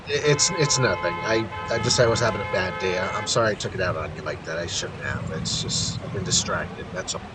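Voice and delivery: quiet, halting, downcast apology; fading to near silence; subdued apology. Acted. Miles confesses a bad day.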